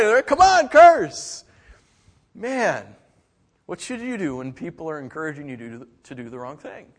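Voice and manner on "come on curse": high, loud, slow drawn-out jeer; taunting bully. Retold or imitated. Bullies goading the boy to curse, slow high loud jeer.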